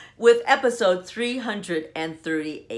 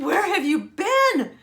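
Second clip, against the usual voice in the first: mock frantic; 11 semitones higher, 4 dB louder, slower than usual. Daughter's frantic question.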